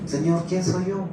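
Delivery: humble prayer. Retold or imitated. Praying in tears at the altar.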